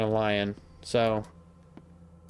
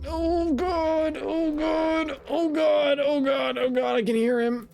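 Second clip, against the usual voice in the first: panicked wail, far higher; terrified; 14 semitones higher, slower than usual. Real, not acted. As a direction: voice cracking up in terror. Monster right behind him.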